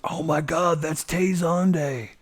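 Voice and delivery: high, slow starstruck gasp; starstruck fanboy. Retold or imitated. Friend imitating a fan seeing [name], awed. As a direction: gush like a star-struck fan. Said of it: "He's like".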